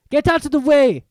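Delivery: loud, sharp high snap; snapping. A narrator's reading. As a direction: snap it at him angrily. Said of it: "snapped Ron".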